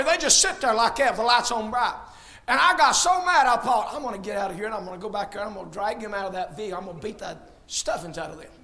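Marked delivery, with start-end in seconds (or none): 3.9-8.5 muttered threat. Retold or imitated. Retold angry thoughts in traffic.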